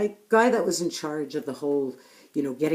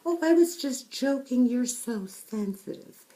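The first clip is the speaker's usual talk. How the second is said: mocking dismissal; 10 semitones higher than usual. Speaker mimicking a bully who dismisses a coworker's hurt, high and sneering. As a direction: mimic the bully waving off the complaint. Bully brushing off a hurtful comment.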